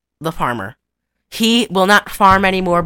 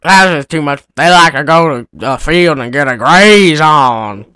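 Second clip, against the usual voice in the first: dazed farmer; 3 semitones lower, 5 dB louder than usual. Retold or imitated. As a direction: sound like the mule kicked you. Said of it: "He sounded like the mule kicked him".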